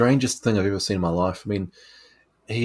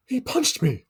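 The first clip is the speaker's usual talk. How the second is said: high squeaky reporter; slower than usual. Retold or imitated. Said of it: "there's a reporter going".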